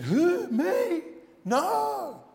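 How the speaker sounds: very high, exaggerated voice of feigned innocence; mock innocent denial. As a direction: imitate a guilty man acting falsely surprised. Mimics the accused man's denial.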